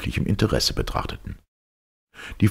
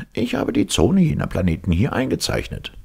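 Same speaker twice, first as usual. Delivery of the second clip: higher-pitched professor; professor's voice; 7 semitones higher than usual. A narrator's reading. Professor showing his chart to the count, high character voice.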